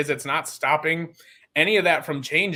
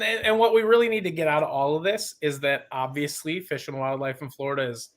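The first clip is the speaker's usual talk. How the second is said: ordinary talk, nothing marked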